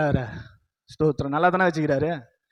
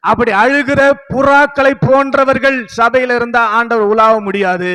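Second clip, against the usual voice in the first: raised, higher-pitched preacher's voice; forceful preaching; 3 semitones higher, 4 dB louder than usual. Real, not acted. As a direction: press each admonition home to the congregation, loud and insistent.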